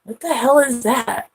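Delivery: high-pitched mock disbelief; baffled cat. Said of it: "She looks at my phone like".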